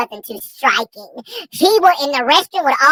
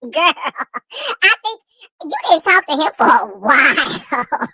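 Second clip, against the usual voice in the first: ordinary talk, nothing marked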